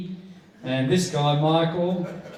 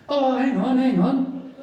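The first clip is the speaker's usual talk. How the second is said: high, loud hang on; sudden realisation; 11 semitones higher, 3 dB louder, slower than usual. Retold or imitated. Drunk man at the table suddenly remembering, high and loud. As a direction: catch yourself mid-thought, pitched up.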